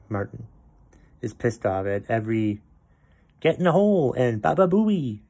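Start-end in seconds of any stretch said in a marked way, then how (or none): 3.4-4.1 a raised mock yell at the green; hammed-up crowd heckle; mock golf yell. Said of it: "yelling asshole at a golf tournament". 4.4-5.2 raised, mocking fan shout; mock golf yell. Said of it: "yelling asshole at a golf tournament".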